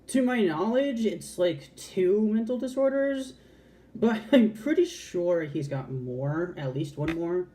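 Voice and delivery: ordinary talk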